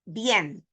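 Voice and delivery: bien as one syllable, "byen"; correct diphthong. Shown as a demonstration. Teacher correcting after the wrong take, joined diphthong. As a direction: glide straight into e in bien. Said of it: "Not muy bien".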